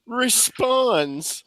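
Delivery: high, loud, drawn-out words through laughter; laughing hard. Real, not acted. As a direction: crack up while telling the story. Storyteller cracking up mid-anecdote.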